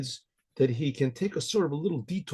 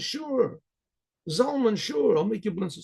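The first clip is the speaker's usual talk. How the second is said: higher, eager wife agreeing; wife's voice; 7 semitones higher, slower than usual. Acted. Wife agrees to make her husband blintzes, higher voice. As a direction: sweetly promise to cook for him. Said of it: "She says".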